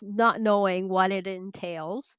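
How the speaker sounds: usual speaking voice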